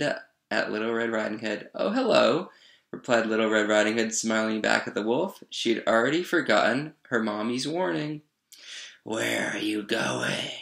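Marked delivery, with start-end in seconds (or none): 1.7-2.5 high, lilting innocent greeting; cheerful little girl. A narrator's reading. 9.0-10.5 growled. A narrator's reading. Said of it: "growled the wolf".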